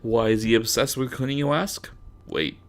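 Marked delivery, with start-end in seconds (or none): none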